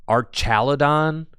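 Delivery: slow, questioning "ch" in Archaludon; doubtful alternative pronunciation. Shown as a demonstration. Host doubting the bridge version, Archaludon with a ch. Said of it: "Archaludon seems kinda silly".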